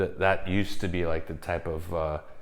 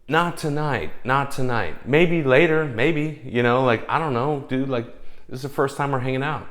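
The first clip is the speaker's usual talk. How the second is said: higher, insistent placating voice; retold pleading refusal; 5 semitones higher, 5 dB louder than usual. Date to woman on a first hangout, pleading refusal. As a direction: act out fending someone off, pleading.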